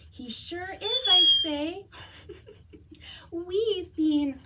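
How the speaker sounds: lively, expressive storybook reading; animated picture-book read-aloud; reading to children. Acted. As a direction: read it like story time for kids.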